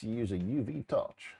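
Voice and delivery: ordinary talk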